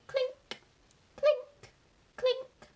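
high, sharp little clinks; imitated metallic clink. A narrator's reading. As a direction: ring out each clink separately. Imitates iron foot on road.

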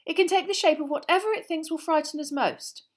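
high, loud know-it-all answer; eager schoolgirl pupil. Acted. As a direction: answer keenly, pitched right up. Voices eager pupil Hermione.

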